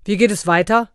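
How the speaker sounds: loud stern question; stern demand. A narrator's reading. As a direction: demand an answer. Santa interrogates, rod raised.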